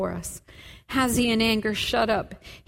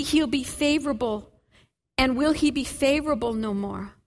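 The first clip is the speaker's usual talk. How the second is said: ordinary delivery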